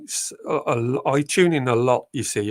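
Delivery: ordinary talk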